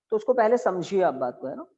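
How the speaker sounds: ordinary talk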